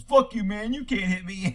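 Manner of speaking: mild mock bravado as a cocky driver; acted smart-ass defiance. Smart-ass driver defying a cop's baton threat, mildly defiant. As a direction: talk back like a smart-ass in a joke. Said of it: "the driver's being a smart ass".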